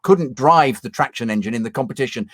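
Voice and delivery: ordinary talk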